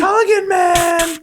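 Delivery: high, loud frontman yell; rock singer shout. Retold or imitated. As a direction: shout it to the crowd. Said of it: "the lead singer goes".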